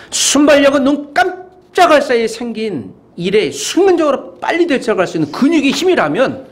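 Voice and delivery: high, driving pulpit oratory; impassioned preaching. Real, not acted. Preacher exhorting the congregation to be spiritually alert, impassioned and high. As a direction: keep the pitch up and push through the list.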